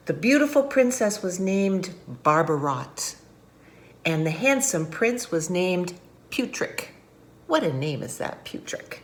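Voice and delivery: ordinary delivery, nothing marked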